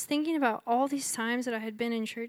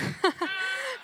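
a burst of high, real laughter; 10 semitones higher than usual. Real, not acted. Laughs at own pun.

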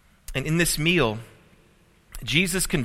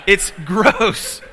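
gross said through a laugh, higher and louder; 6 semitones higher, 8 dB louder than usual. Real, not acted. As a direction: crack up as you repeat it. Repeats while laughing.

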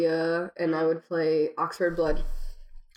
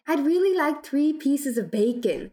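Midwestern accent; 8 semitones higher than usual. Shown as a demonstration. Joining the Midwestern accent game.

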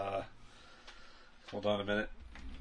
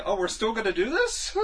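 high, slow, incredulous; mock disbelief; 9 semitones higher, 4 dB louder than usual. Friend retelling her reaction to the movie, high mock disbelief. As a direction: act amazed they keep going. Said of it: "And then I'm like".

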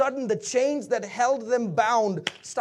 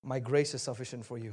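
low, soft, unmoved answer; reply with quiet authority; hushed, settled refusal; calm divine reply; 9 semitones lower, 7 dB quieter than usual. Acted. Voices God's answer to the plea.

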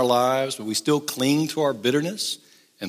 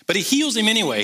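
emphatic preaching; 9 semitones higher, 4 dB louder, faster than usual. Real, not acted. Preaching climax.

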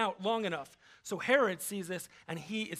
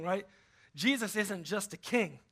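ordinary talk

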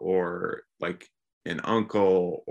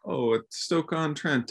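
higher-pitched, slow put-on quote; mock knowing remark; 7 semitones higher, slower than usual. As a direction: draw it out like someone showing off a place name. Voices people talking ceramics.

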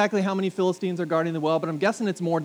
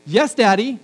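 bright, higher and louder eager yes; eager daughter; 5 semitones higher, 8 dB louder than usual. Retold or imitated. Dad imitates his daughter agreeing eagerly to clean her room, bright and higher. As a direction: chirp it like a happy child. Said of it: "I either get".

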